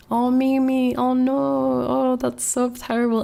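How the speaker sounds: mock sympathetic chat. Streamer imitating viewers pitying her, high cooing. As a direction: coo it like fussing over a hurt pet. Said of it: "then everyone's like".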